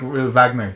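Wagner said with a V, "Vagner"; German W. Shown as a demonstration. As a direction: start Wagner on a V sound. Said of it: "it's Wagner".

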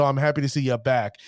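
usual speaking voice

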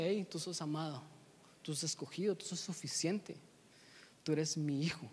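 soft, unhurried affirmation; quiet, tender list, slowing toward the end; gentle reassurance. Acted. God telling us we are loved, soft and reassuring.

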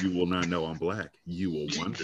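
low, quiet sultry phone voice; deep sultry voice. Shown as a demonstration. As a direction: drop it low and smooth. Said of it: "if I talk to you like this".

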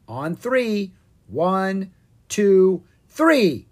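countdown building to high loud. Acted. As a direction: count up to the launch. Countdown before takeoff.

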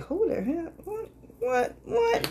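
high-pitched incredulous what; rising astonished repeats; squeaky mock shock; mock disbelief. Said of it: "it's like".

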